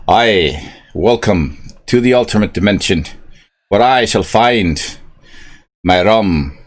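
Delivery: loud, hammed-up Scottish pirate that drifts Irish; a half-Irish stab at a Scottish pirate; attempted Scottish accent. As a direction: boom it out as a drunk Scottish space pirate. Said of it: "I don't even know if I can do a Scottish accent".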